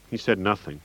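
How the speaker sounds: ordinary delivery, nothing marked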